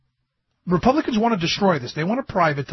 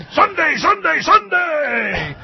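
monster truck announcer; 10 semitones higher, 4 dB louder, slower than usual. Acted.

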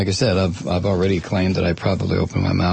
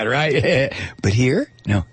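ordinary talk

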